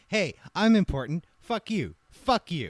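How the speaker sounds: high, loud, self-important mock insult; mock arrogant outburst. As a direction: play a puffed-up bully shouting people down. Acts out arrogant official bullying someone.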